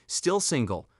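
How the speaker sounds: ordinary delivery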